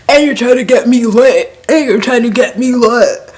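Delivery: mock calling out. Girlfriend refusing the shot, mock loud accusation. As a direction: call him out in a hammed-up high voice. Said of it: "she calls it out".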